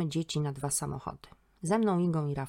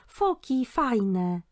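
put-on high, bright child's voice, delighted; a small boy thrilled by seals; excited little boy; 7 semitones higher, 5 dB louder, much slower than usual. Little boy at the seal pool.

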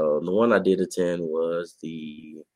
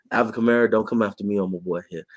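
ordinary talk

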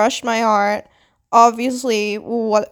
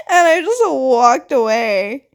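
slow, rueful laughing retelling; 5 semitones higher, slower than usual. Real, not acted. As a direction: laugh through the words, drawn out. Laughs while telling own rejection.

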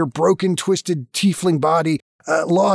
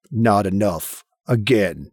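low, restrained command; ominous low demand; voice behind the door; 8 semitones lower, slower than usual. Acted. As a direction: intone it low from beyond the door. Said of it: "louder and more insistent".

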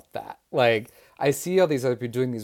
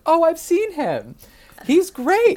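much higher, louder, slowed gush of praise; gushing imitation; 10 dB louder, slower than usual. Retelling her praise of him, high gushing imitation. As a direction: gush like a delighted star. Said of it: "And she was like".

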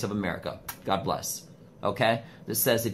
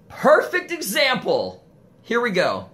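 loud, high, slowed call across the room; real shout; 13 semitones higher, 7 dB louder, slower than usual. Real, not acted. Calling out to a passer-by, a sudden loud shout. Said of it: "why are you yelling?".

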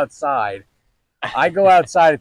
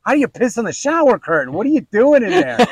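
higher, louder, quick disbelief; can't fathom how she did it; loud incredulous question to his wife; 9 semitones higher, 6 dB louder, faster than usual. Retold or imitated.

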